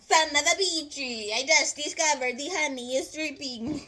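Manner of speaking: put-on Italian accent. Discovering the honey is dripping, in an exclaiming Italian accent. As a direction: exclaim like a comic Italian cook. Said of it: "Forgive my Italian accent".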